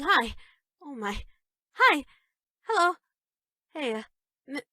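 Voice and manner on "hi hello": usual speaking voice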